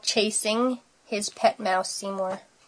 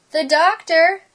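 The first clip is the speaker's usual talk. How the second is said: high, loud, rising disbelief; shocked question; 7 semitones higher, 5 dB louder than usual. A narrator's reading. Spike hears about the checkup, shocked. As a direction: echo it in wide-eyed alarm. Friend reacts wide-eyed.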